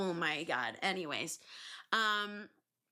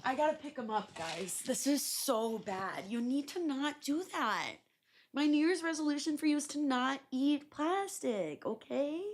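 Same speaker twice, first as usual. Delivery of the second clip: high, coaxing scold at the cat; pet-scolding voice; 6 semitones higher than usual. Real, not acted. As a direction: tell the pet off, sing-song. Scolding pet eating plastic.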